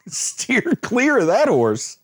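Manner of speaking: laughing through the words, slow; giggling out a slow warning. Real, not acted. Vlogger laughing at the biting horse, laughing.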